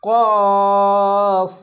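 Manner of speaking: কফ with its vowel drawn out long; held three alif. Shown as a demonstration. Teacher demonstrating the three-alif stretch to students. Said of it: "তিন আলিফ টেনে পড়বেন".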